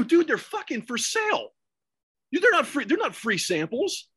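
high-pitched incredulous telling-off; retold alarmed protest. Retold warning to friend eating cookies.

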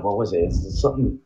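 ordinary talk, nothing marked

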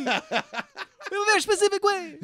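put-on very high, slow, laughing Italian accent. Mock Italian accent joking about catfish to friend. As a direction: drag out a hammy Italian accent. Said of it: "enough to do an Italian accent".

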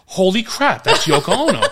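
high, amazed outburst; high-pitched astonishment, retold for laughs; starstruck shock. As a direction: act out stunned recognition. Acted reaction to celebrity walking in.

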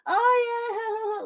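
airy high phone gossip, ignoring the caller; mom chatting on the phone. Retold or imitated. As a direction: mimic a distracted mother gabbing with friends. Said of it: "Like, she would be doing that".

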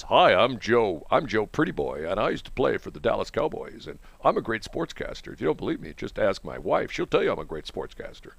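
low, smug mock announcer voice; phony sportscaster. Host mocks ex-player turned sportscaster, low and phony. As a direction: introduce yourself with soft, self-satisfied fake polish. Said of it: "you got these other guys".

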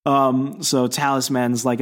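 normal talking voice

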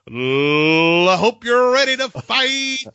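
loud high boxing announcer call; fight announcer. Pretend. Mocking announcer line.

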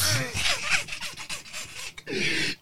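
long high-pitched cackle of disbelief; helpless shrieking laugh. Real, not acted. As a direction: break up laughing. Laughing after the threat.

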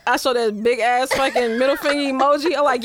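ordinary talk

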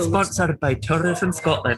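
slow laughing ad tagline; mock ad voice. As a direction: read it like a tourism ad.

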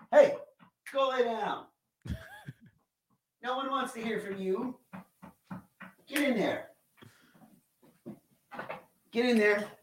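ordering the dog off-mic. Real, not acted. Owner sending his dog to lie down, off-mic command. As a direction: call to a dog away from the microphone.

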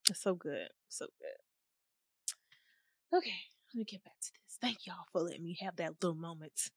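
hushed, overcome whisper. Real, not acted. Speaker thanks listeners in a hushed, moved whisper. Overwhelmed, whispering after tears.

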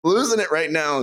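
high-pitched hammed-up alarm; mock freak-out. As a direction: act out losing it. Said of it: "I said".

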